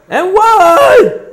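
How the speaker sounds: And one shouted high and loud; celebratory shout. Acted. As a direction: yell it out like you just scored.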